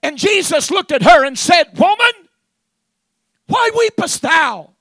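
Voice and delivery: loud, high proclamation; preaching at full force. Acted. Preacher retelling Jesus questioning Mary, loud preaching. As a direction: call out the question from the pulpit.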